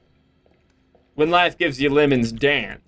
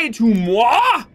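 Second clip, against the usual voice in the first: mock posh; 4 semitones higher, much slower than usual. Mock French "moi.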